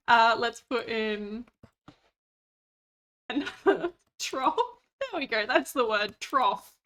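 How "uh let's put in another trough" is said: words broken by laughter; laughing. Real, not acted. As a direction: giggle through the line.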